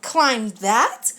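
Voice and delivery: incredulous elephant question; lightly voiced dismayed Horton; shocked questioning echo; gulping disbelief. A narrator's reading. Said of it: "Horton gulped".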